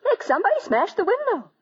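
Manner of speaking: startled, calling Nick in fright; alarmed cry. Acted. Window smashed, alarmed wife.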